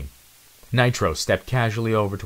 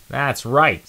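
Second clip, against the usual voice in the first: higher, louder gloating; smug; 6 semitones higher, 6 dB louder than usual. Acted. Nitro gloats at the cornered squad, smug. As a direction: gloat like you caught them. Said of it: "said a speckled mouse".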